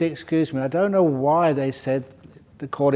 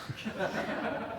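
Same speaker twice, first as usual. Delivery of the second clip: airy laugh; 8 semitones higher, 14 dB quieter than usual. Real, not acted. Laughing after punchline.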